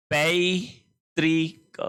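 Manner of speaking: पैतृक very slowly in parts, pai - tree - k; syllable-by-syllable model. Shown as a demonstration. Teacher dictating the correct spelling, पैतृक slowly broken into syllables. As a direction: stretch पैतृक out, long ri in the middle. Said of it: "पैतृक इस तरह से लिखा जाएगा".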